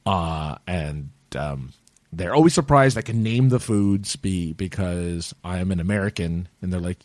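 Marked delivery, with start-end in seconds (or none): none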